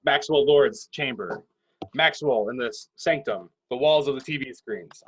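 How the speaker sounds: ordinary talk, nothing marked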